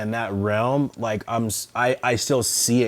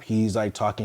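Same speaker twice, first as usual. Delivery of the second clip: ordinary talk, nothing marked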